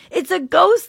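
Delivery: high, loud frightened cry; cried out in fear. A narrator's reading. Disciples seeing Jesus on the lake, high loud fearful cry. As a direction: yell it like you've seen a ghost. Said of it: "cried out in fear".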